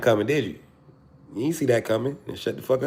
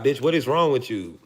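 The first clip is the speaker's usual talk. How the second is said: normal talking voice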